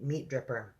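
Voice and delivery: plain reading voice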